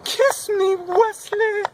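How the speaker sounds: high, crying kiss me; tearful plea. Acted. Buttercup begging Wesley for a kiss, high and crying. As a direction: sob it out, longing.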